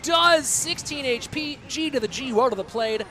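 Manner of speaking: rising to a loud, high shout on the connect; shouting with excitement as the tower falls; excited call. Real, not acted. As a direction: hype the hit like a caster. Caster calls a big hit.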